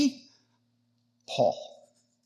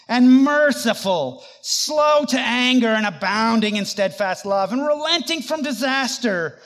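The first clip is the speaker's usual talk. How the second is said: angry prayer; 2 semitones higher, 4 dB louder than usual. Acted. Jonah prays to God after Nineveh repents, angry and loud. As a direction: protest bitterly, building to a loud finish. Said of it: "and he was angry".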